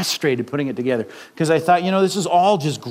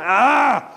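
exasperated groan; 6 semitones higher than usual. Pretend. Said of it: "He's like".

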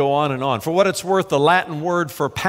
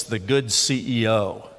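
slow, softer, faintly mocking "the good C E O"; mock suggestion; 5 semitones lower, 4 dB quieter, slower than usual. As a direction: lightly parody the modern idea, drawn out. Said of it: "No, we ought to talk about Jesus".

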